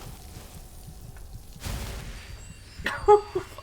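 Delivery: high wheezing breathless laughter; helpless laugh. Real, not acted. Streamer cracks up after getting flattened, wheezing laugh. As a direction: laugh helplessly at your own defeat.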